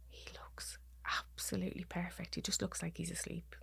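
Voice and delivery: very soft, half-whispered tenderness; retold hushed comfort to the bereaved; gentle reassurance. Retells uncle gently describing the body.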